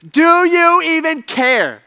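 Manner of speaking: panicked plea. Retold or imitated. Disciples waking Jesus as the boat sinks, panicked plea. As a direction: cry out accusingly, stretching the words. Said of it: "a bunch of chaos and a bunch of panic".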